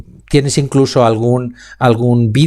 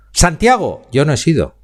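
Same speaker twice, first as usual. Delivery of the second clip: "Santiago" called out high, then a hit on "no"; stress on no; 4 semitones higher than usual. Shown as a demonstration. Speaking coach showing students how stress changes meaning, punching no. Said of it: "en donde yo ponga la velocidad y la entonación".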